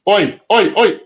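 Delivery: loud, high, sharp repeated calls; scolding the dog. Real, not acted. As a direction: call an unruly dog to order. Calling the dog to order.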